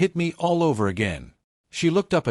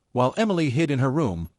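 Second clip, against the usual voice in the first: ordinary delivery, nothing marked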